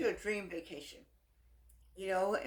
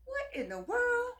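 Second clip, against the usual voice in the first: high, slow, disbelieving cry; incredulous; 4 dB louder, slower than usual. Real, not acted. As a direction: recoil in mock horror. Shocked at bad eyelashes.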